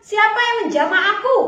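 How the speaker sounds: calling out to crowd. Acted. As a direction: call over the crowd searching. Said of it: "Yesus bilang". (bertanya lantang ke kerumunan)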